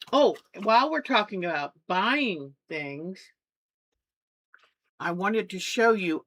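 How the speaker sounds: normal talking voice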